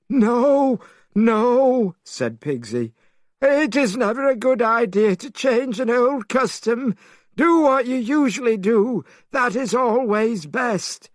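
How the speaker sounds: Pigsy pleading. A narrator's reading. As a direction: plead in a high panicky rush.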